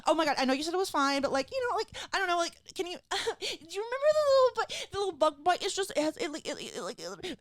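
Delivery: high, fast, flustered stammer with nervous laughs; embarrassed questioning. Retold or imitated. Patient sheepishly questioning her doctor about a bug bite, embarrassed. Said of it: "embarrassed to question people".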